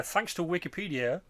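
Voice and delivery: ordinary talk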